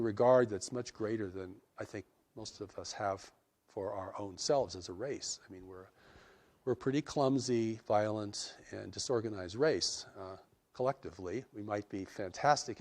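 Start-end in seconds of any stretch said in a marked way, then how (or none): none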